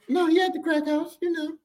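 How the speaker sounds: high, breezy matter-of-fact answer; mom blunt. Retold or imitated. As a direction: toss it off casually on the phone. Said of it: "This is how phone calls used to go".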